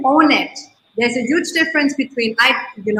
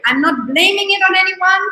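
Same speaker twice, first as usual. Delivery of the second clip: ordinary delivery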